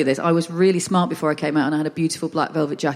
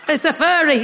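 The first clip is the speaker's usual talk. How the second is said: mum's voice, laughing; 9 semitones higher, 4 dB louder than usual. Retold or imitated. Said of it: "I woke up to them having a little chat".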